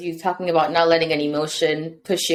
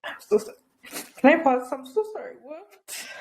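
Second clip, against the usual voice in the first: high, faint, tearful apology; real crying, emotional; 9 semitones higher, 4 dB quieter than usual. Real, not acted. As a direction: ask for a pause through tears. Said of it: "I'm getting emotional".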